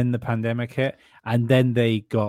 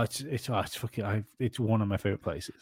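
mock tearful; 3 semitones lower, 9 dB quieter than usual. Mock tearful praise of Germany.

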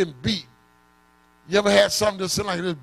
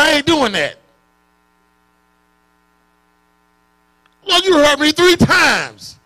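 acted plea; 7 dB louder than usual. Acted dialogue with the Lord.

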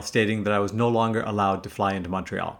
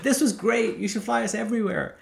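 higher-pitched happy passenger; delighted praise; 9 semitones higher, 4 dB quieter than usual. Retold or imitated. Friend Peter praising the pilot on approach, delighted. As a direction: imitate a pleased friend, pitched up.

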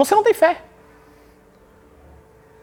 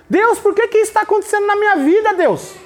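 victim's question; 7 semitones higher than usual. Shown as a demonstration. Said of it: "Pergunta vitimista".

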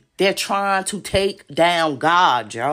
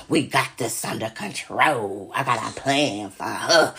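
lower, slower smug plotters; low mocking voice; 9 semitones lower than usual. Imitating the plotters against her, low and smug. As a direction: play them low and smug. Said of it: "they still with the".

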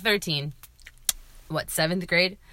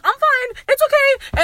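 squeaky brave fine; 5 dB louder, slower than usual. Retold or imitated. Quoting herself hurt, pretending fine.

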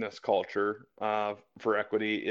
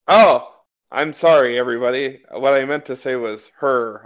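mock on-air apology; 9 dB louder than usual. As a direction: play a caught-out host backpedaling. Mock on-air apology as the flustered host.

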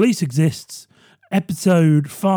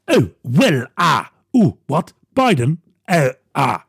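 lower, halting Boris voice full of uhs; Boris Johnson impression; 4 semitones lower than usual. Boris switching sides on Trump, bumbling and lower. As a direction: bluster and stammer like Boris Johnson. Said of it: "Boris Johnson impressions".

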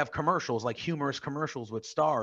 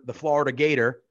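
normal talking voice

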